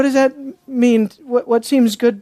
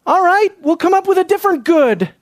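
mock acquiescence; 9 semitones higher than usual. Imagined reply of the men.

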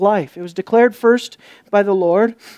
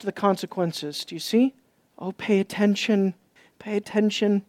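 much softer, earnest warning; soft urgent plea; 8 dB quieter than usual. Real, not acted. Pastor softly urging the congregation, hushed earnest plea. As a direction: lean in and plead quietly. Preacher pleads softly after climax.

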